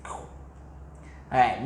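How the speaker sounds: ordinary delivery, nothing marked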